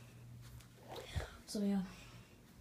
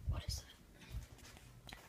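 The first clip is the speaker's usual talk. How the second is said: whisper; 17 dB quieter, much slower than usual. Real, not acted. Slow whisper discovering something.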